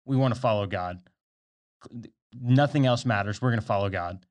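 mildly enthusiastic group pledge; a touch of mock zeal. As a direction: declare it keenly, imitating eager followers. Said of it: "They were enthusiastically saying".

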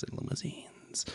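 breathy whispered sign-off; whispered radio host. Pretend. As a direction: whisper the last word like a DJ. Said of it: "I sound like I'm a seventies radio host".

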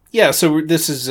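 ordinary talk

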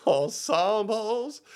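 "Ensembles" stretched slow, rising end; drawn-out ensembles. Acted. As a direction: draw out "Ensembles" with a lifting end. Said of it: "What was that?".

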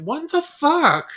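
high, loud, drawn-out disbelief; slow, rising cry of bewilderment; baffled outburst. Retold or imitated. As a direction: exclaim it in stunned outrage. Baffled reaction to Eric being nice.

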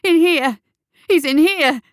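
high, loud alarm call; top-of-the-voice urgent yell; howling shout. A narrator's reading. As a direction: holler to bring everyone running. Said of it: "he howled at the top of his voice".

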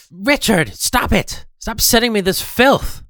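high, loud, outraged yell; angry shout. Acted. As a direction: yell at him to stop. Yelling at lewd messages.

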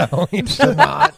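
slow, high laughing reply. Real, not acted. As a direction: answer while cracking up. Laughing at mean joke.

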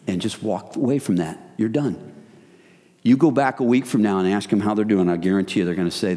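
ordinary talk, nothing marked

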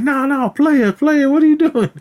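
high, loud, alarmed pleading; panicked plea. Retold or imitated. Shoplifters begging Money Mike with the razor, high and panicked. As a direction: beg him to stop, voice up high. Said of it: "And they're like".

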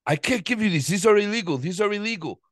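put-on high-pitched, agitated Indian accent; loud mock Indian-accented protest. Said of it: "Did he have an accent like that?".

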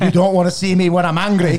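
Scottish Hulk. Retold or imitated. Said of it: "I don't know why he's Scottish".

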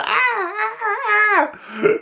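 high-pitched imitated wail; high, wavering mock scream. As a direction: wail "ah" in a high, laughing mimicry. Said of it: "You can see Charlie at the end going".

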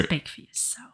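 breathy whispered aside. Real, not acted. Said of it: "whispered aside".

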